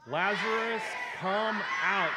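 high, full-force calling shout; loud yell. Acted. Preacher leading the group as Jesus calling Lazarus out, loud yell. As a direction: yell it out long and commanding. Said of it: "Jesus yells that in a loud voice".

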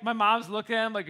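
ordinary talk, nothing marked

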